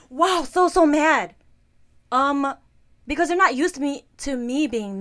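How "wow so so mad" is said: louder, higher gasp of surprise at her anger; mock shocked reaction. Imitating shocked listeners reacting to her anger, louder and higher. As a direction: act out people's amazement. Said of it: "a lot of people are blown away".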